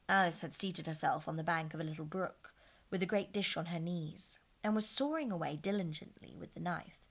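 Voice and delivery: plain reading voice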